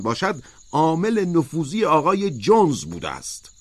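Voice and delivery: deliberate, insistent official pronouncement; measured and firm. A narrator's reading. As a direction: press each phrase home with authority.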